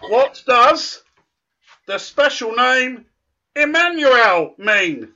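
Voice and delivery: slow question broken by pauses; deliberately slow. Shown as a demonstration. Quiz host giving children a chance, slow and spaced out. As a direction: space out each phrase, stretching the last. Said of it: "I'll say it slowly to give you a chance".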